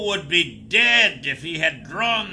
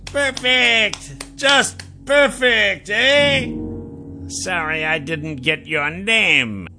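delighted applause; 11 semitones higher, much slower than usual. Acted. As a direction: savour it like a showman. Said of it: "The Jackal applauded".

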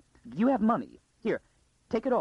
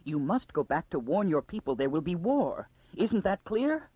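terrified urgency; 6 semitones higher than usual. A narrator's reading. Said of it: "Absolute terror seemed paradoxically to have lent a lucidity".

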